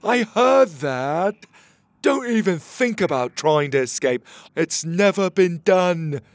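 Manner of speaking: loud, slow, pompous guard voice; threatening guard. Acted. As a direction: warn the prisoners, loud and drawn out.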